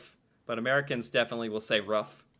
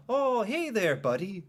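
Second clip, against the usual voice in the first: put-on chirpy higher Minnesota accent; mock Minnesota accent; 6 semitones higher than usual. Mocking American podcasters' Minnesota-style accent. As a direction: do a folksy Minnesota accent impression. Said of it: "That's kind of the".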